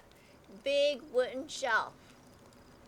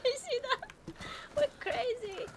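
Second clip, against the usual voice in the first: high, excited giggly voice; thrilled and giddy; 12 semitones higher than usual. Real, not acted. Excited after hit.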